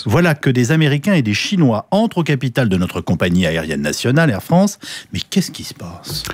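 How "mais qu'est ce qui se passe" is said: hushed, slow stage whisper. Acted. Radio host to listeners, mock-alarmed slow whisper. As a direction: whisper it like a shocked secret.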